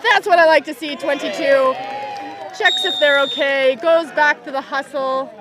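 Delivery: loud, lively commentary; excited praise. Real, not acted. Commentator praising a kind tackle from Italy. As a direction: cheer the good sportsmanship on.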